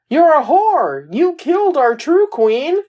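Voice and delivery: high-pitched imitation of a screaming woman; mock scream. Recapping a woman running in at the church, mock screaming. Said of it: "running in and screaming".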